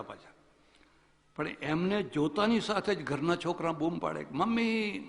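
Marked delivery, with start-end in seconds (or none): 4.3-5.0 high call to mother; kids calling out. Retold or imitated. Children shout to mother as the guest arrives, high call. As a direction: shout for mummy at the door.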